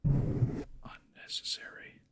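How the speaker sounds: usual speaking voice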